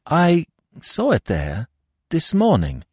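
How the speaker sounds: slow, high-pitched, hesitant statement; slow admission. A narrator's reading. Narrator admitting it to the commissary, slow admission. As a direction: let the words out slowly, piece by piece. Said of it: "I said slowly".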